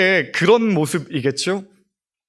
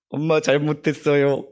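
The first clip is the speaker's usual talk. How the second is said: put-on apologizing child. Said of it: "나 잘났다고 대들다가".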